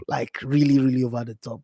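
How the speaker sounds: ordinary delivery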